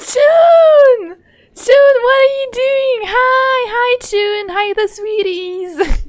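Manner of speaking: high, loud squeal of delight; greeting a friend in chat with high delighted squeals; excited squealing greeting. Real, not acted. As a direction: squeal hello to a friend you missed.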